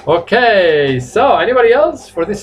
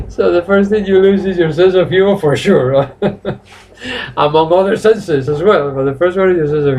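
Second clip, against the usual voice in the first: laughing; 3 semitones higher than usual. Real, not acted. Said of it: "laughs heard".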